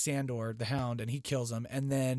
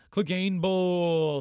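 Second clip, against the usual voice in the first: higher, louder and slow, stretched like an announcer; drawn-out hype yell; 5 semitones higher, 7 dB louder, much slower than usual. Real, not acted. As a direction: call it out like a ring announcer. Hyping up the big fight.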